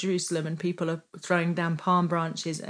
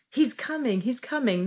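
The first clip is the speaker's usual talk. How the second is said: mild mock yell, raised in pitch; higher, lightly imitated child's cry; 5 semitones higher than usual. Repeating what she was yelling yesterday, mild imitated yell. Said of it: "That's what she was yelling yesterday".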